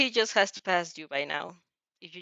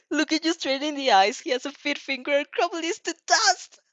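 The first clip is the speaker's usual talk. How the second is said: anguished; 6 semitones higher than usual. Real, not acted. Reader reads the loss crying out.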